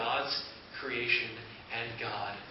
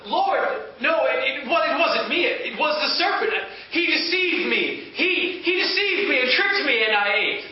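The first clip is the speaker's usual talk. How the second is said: much higher and louder, stammering denial; Eve pleading; 11 semitones higher, 8 dB louder than usual. Acted. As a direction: blame the serpent in a frantic rush. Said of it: "And Eve said".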